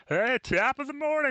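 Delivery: loud, very high-pitched hammed-up greeting; Chad Snyder. Host waking up with a podcaster's voice stuck in him, loud high impression. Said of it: "such a good Chad Snyder impression".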